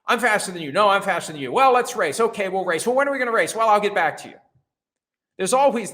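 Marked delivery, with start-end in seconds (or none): 0.0-4.3 high-pitched, louder back-and-forth squabble; mock bickering. Two athletes trading challenges to race, mock bickering. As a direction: trade boasts like two squabbling rivals.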